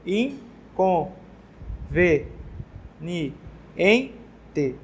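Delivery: "In, com, ve, ni, en, te" split with pauses; teacher separating syllables for the class. Shown as a demonstration. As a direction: count out each syllable separately. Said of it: "Eu contei certo as sílabas aí".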